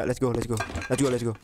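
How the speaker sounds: normal talking voice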